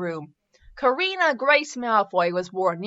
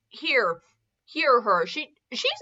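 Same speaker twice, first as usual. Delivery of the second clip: ordinary talk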